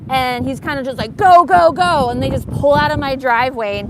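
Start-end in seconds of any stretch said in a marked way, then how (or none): 1.2-2.1 high, loud hurried go go go; mock panicked shout to drive off; urgent shout. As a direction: yell at the driver to pull away. Dimitri urging brother to drive.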